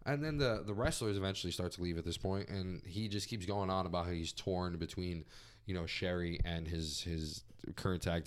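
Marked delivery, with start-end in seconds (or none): none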